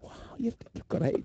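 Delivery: whisper. Pretend. Whispered baby talk.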